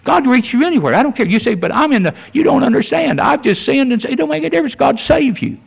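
high, impassioned preaching climax; fervent preaching. Acted. Pastor urging the backslidden congregation, high impassioned preaching. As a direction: lift it up to a full pulpit cry.

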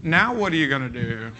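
ordinary talk, nothing marked

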